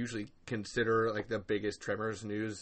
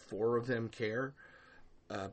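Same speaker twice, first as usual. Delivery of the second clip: normal talking voice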